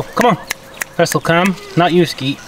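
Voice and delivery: ordinary delivery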